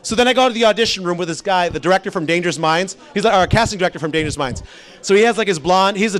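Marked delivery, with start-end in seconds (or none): none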